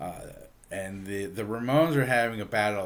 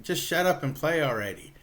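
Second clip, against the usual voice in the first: higher-pitched mock heckle; retold heckling; 6 semitones higher than usual. Guy in the audience to the band, mock heckling. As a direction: imitate an impatient audience member. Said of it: "he's heckling".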